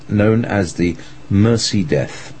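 ordinary delivery

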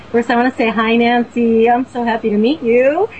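normal talking voice

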